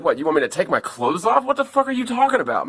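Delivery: rising to a high, fast panicked cry; freaking out. Retold or imitated. Mina panicking at the intruder's order, high and fast. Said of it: "Mina was freaking out".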